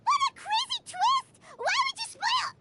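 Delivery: gasp, then high, loud shriek; outraged shock. Acted. Paimon reacting to a spoiler, shocked and high-pitched. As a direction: squeal it out, scandalised.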